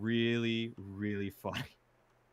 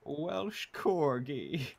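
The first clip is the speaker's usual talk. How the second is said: relishing quote; 4 semitones higher, much slower than usual. Retold or imitated. Savoring the quoted line.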